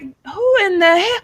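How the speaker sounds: high, rising mock shock; retold disbelief. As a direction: act out appalled disbelief, climbing very high. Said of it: "I was like".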